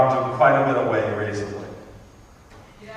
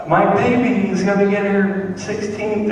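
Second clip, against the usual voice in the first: proud mother; 7 semitones higher than usual. Retold or imitated. Proud mother voiced in stand-up bit.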